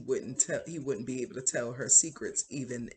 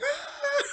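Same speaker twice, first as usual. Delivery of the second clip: very high laughing shriek; playful hammed-up scream; mock scream; 4 dB louder than usual. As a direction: squeal in fake terror, half laughing. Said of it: "He just starts screaming".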